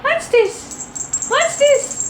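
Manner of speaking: high cooing to the cat. Real, not acted. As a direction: coo it like to a pet. Coos at the cat.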